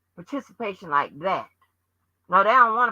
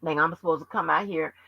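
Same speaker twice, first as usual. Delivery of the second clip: ordinary delivery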